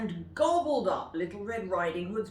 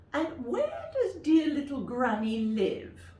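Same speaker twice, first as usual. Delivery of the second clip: high, loud, chuckling wolf; wolf wheedling the girl; sly sweet wolf; 10 semitones higher, 6 dB louder than usual. Acted. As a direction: fake sweetness with a laugh. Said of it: "asked the wolf".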